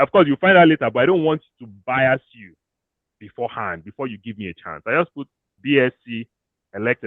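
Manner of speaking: usual speaking voice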